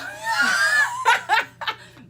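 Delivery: shrieking burst of laughter; big high laugh; loud high laugh. Real, not acted. Silly reaction to word.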